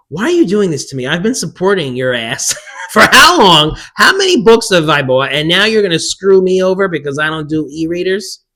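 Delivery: loud, high-pitched outraged complaint, half laughing midway; mock indignant rant. Loyal reader scolds publishers over e-book-only novellas, mock indignation. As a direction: rant at them in playful outrage.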